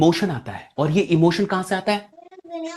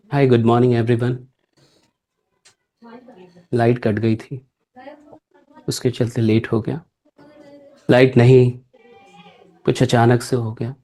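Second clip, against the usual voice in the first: lower, slow, downcast greeting; sad; 9 semitones lower than usual. Shown as a demonstration. Speaker greeting an audience with a sad face, lower and slow. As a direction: greet the room with a fallen face. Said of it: "उदास चेहरा बना लीजिए सब लोग".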